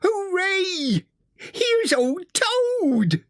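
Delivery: very high, joyful cheer; beaming delight. A narrator's reading. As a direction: cheer a friend's arrival in a high, drawn-out cry. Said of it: "beamed the mole".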